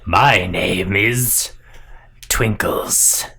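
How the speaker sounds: slow, voiceless creature whisper; drawn-out whispery monster voice; whispering snake guard. Acted. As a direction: whisper your name slowly as a serpent. Second snake guard introduces itself.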